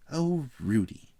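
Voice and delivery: high, loud, drawn-out exasperation; exasperated. A narrator's reading. Mother exclaiming at her son, exasperated. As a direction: stretch it out as a fed-up mother. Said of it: "exclaimed his mother in exasperation".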